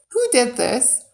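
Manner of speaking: ordinary delivery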